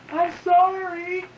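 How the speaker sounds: tiny high slow apology; meek little high voice, slow. Retold or imitated. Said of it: "this little voice".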